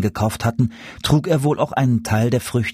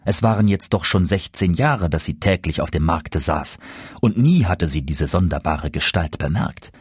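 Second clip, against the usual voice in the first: ordinary delivery, nothing marked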